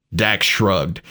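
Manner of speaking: plain reading voice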